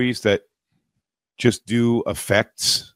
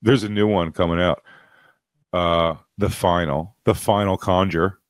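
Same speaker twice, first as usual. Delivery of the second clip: ordinary delivery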